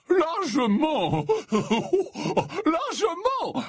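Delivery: high, very drawn-out, chuckling; hearty assurance. A narrator's reading. Slughorn assures colleague he has plenty, hearty. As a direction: stretch each word round a chuckle. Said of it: "assura Slughorn".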